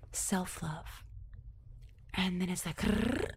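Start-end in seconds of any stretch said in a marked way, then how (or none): 2.8-3.3 a rolling throaty rrrr; short rolled growl; imitated growl. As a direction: growl it out like a sound from the vision. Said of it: "through that sound".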